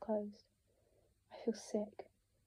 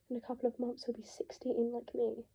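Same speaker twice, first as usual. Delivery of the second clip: ordinary delivery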